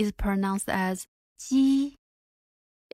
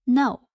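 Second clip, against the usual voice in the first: "No." dropping sharply from high, louder; falling negation; 4 semitones higher, 6 dB louder than usual. Shown as a demonstration. Teacher comparing fourth tone to English no, falling. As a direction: say a firm falling "No.". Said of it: "the same as the English negation word".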